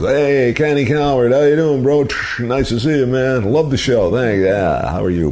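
high, eager hey-buddy greeting; excited ushers greeting. Retold or imitated. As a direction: call out like a delighted fan spotting him. Ushers greeting him loudly.

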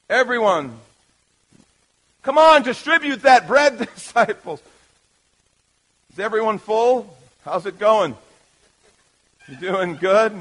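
ordinary delivery

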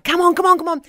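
urging on. Retold or imitated. Husband behind her egging her on, urgent mimicry.

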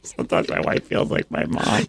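whispered confession. Real, not acted. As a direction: breathe it out unvoiced. Confiding aside.

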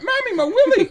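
put-on very high child voice through laughter; excited little boy. Son showing mum his success, squeaky imitated child voice.

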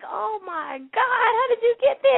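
high, acted-out shock; mock-horrified, pitch shooting way up; retold astonishment. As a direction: re-enact a flabbergasted "oh my God". Retelling shock at resident on table.

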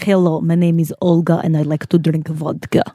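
put-on Russian accent. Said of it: "Russian is more like".

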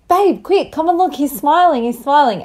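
high, excited urgent call. Retold or imitated. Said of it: "I say to you".

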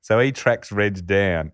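normal talking voice

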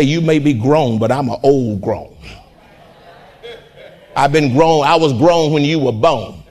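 low, knowing parent's retort; the seasoned parent setting grown kids straight; parent talking back. Retold or imitated. Parent answering a grown child, low and knowing. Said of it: "you say".

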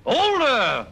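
a wounded ham's outraged squawk; indignant shout. Acted. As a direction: bristle at being called old. Old actor told he's too old.

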